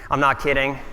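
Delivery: ordinary talk, nothing marked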